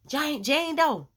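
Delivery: long, loud call across a distance; hollering a friend's name, drawn out. Retold or imitated. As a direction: stretch the name out to reach her far away. Calling friend's name across campus.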